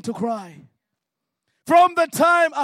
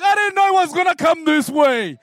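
loud, higher, helpless protest; exasperated protest; 6 semitones higher, 6 dB louder than usual. Retold or imitated. A crying man loudly protesting to Jesus. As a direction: throw up your hands and shout it. Retold exchange with God.

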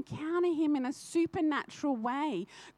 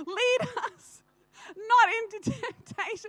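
corrected prayer line, crying; 9 semitones higher than usual. Real, not acted. Correcting her slip before the church, crying. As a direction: Lead us not into temptation, higher and choked with real tears. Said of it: "What is that, right?".

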